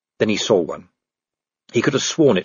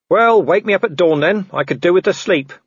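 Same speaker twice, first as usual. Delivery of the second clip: raised, loud defiant call; called-out retort; 8 semitones higher, 4 dB louder than usual. Acted. Vimes answering the werewolf from the tree, called-out retort. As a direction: shout a cocky comeback.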